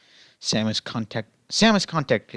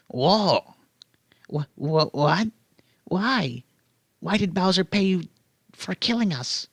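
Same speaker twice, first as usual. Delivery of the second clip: higher, bewildered Yoshi voice; Yoshi's own tone; 6 semitones higher than usual. Acted. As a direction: ask it like a baffled little dinosaur. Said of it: "Yoshi asked in his own tone".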